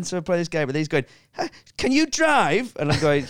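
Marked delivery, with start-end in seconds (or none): 1.3-2.7 high, slow Irish boy impression; Irish lad. Irish kid questioning an older gamer, high Irish voice. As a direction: draw it out like a curious Irish kid. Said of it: "He was this Irish".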